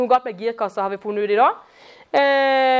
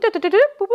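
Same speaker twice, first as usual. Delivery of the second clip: playful high beeps like keypad buttons; beeping button noises; 7 semitones higher, much faster than usual. Retold or imitated. Preacher mocking prayer as a vending machine, playful beeps. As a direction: punch in a code on a soda machine, beep beep.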